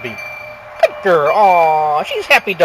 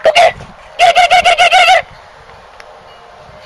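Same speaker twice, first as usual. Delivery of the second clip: yelling from the sidelines; 5 dB louder than usual. Real, not acted. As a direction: cheer the dog on at full voice. Cheering dog on to fetch.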